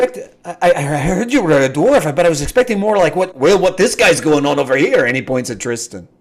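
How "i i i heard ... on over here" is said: a hint of old dwarf. Old dwarf describes expectations.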